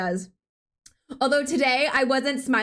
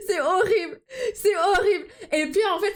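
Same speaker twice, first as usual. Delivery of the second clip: higher, giggly through the words; laughing; 6 semitones higher than usual. Real, not acted. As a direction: laugh at your own impression. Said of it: "laughing at own voice".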